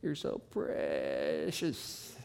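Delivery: slow, low Southern Texas drawl stretched over many syllables; drawn-out Southern Texas drawl. Retold or imitated. Preacher mimics a Texas woman, slow Southern drawl. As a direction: stretch each word like a Texas lady. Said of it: "they gotta do it in a few syllables".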